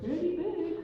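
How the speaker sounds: high-pitched suitor's boast; acted high, hopeful little reply; Blue Jay. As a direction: answer the dad way up high. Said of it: "And Blue Jay's like".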